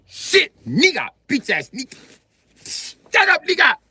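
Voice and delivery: high, loud mock-angry bursts; holding back anger. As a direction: spit each word like you're about to slap. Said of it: "holding back anger, like you finna slap somebody".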